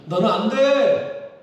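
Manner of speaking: loud, slow, final refusal; stern God voice. Pretend. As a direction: cut him off hard as God. God cuts Moses off. (하나님이 모세를 단칼에 자르며 크고 느리게)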